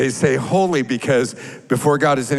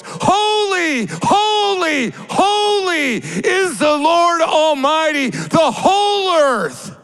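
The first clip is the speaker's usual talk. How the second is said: antiphonal cry; 12 semitones higher, slower than usual. Acted. Preacher voicing the seraphim, crying out high and slow. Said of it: "calling out in antiphonal voice".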